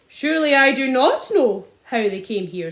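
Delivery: snapped. A narrator's reading. Landowner snapping back at the father. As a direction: bite the words off. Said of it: "snapped Mister Hazel".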